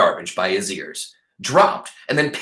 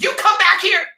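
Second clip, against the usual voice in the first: shrill, very high, loud yell; shouting; 6 dB louder than usual. Acted. Ernest screams at the dog running off with his find, shouting. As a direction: scream after a thief. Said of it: "Ernest shouted".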